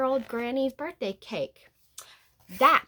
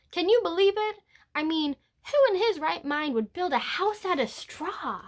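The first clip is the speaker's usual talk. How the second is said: incredulous; 8 semitones higher than usual. A narrator's reading. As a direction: scoff in amazement. Incredulous about straw house.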